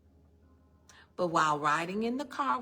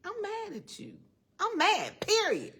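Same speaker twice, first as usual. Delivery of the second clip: much higher, loud sulky protest; mock anger at God; 12 semitones higher, 5 dB louder than usual. Preacher retells shouting her anger at God, a hammed-up tantrum. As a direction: stamp your foot, a childish tantrum. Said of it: "I told God".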